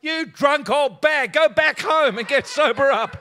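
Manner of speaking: high, loud shout, drawn out; mock angry retort. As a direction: yell back in hammed-up outrage. Acts out his first angry thought.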